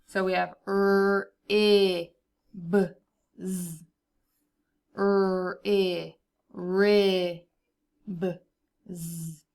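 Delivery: slow sound by sound; sounding out words. Shown as a demonstration. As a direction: split into separate sounds. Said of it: "Let's go ahead and blend it".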